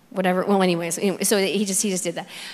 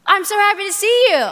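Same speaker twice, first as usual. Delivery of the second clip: joyful dad; 10 semitones higher, 6 dB louder than usual. Retold or imitated. Dad voiced greeting her at door.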